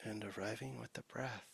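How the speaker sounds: soft, unhurried meditation voice with long pauses; guided meditation. Real, not acted. Teacher leading the sangha's formal practice, soft guided meditation. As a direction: ease listeners gently into stillness.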